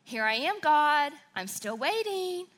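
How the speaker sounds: loud and high, taunting God; mocking proclamation. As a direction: call it out loudly to the ceiling, daring. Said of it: "the professor proclaimed".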